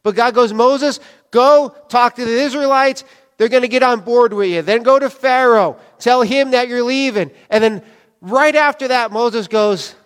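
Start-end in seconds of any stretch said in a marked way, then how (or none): 0.5-7.3 raised, emphatic voice giving orders; higher, louder imitation of God commanding; retold command. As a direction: send him off with brisk authority. God briskly ordering Moses in retelling.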